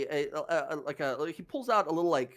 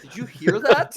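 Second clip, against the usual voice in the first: startled guard; 5 semitones higher, 11 dB louder than usual. Acted. Guard hears cat.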